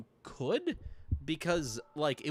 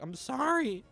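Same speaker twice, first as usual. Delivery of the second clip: high, meek mock apology; mock sheepish Sonic; 11 semitones higher, 3 dB louder, slower than usual. Gamer mocks Sonic apologizing to his friends, high and meek. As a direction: squeak it out like a guilty kid. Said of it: "Sonic's like".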